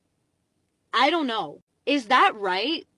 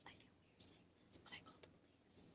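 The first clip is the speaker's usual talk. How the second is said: barely audible, voiceless whisper; hushed confession; 35 dB quieter, much faster than usual. Real, not acted. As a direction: whisper it like a secret. Awkward confession in hushed secrecy.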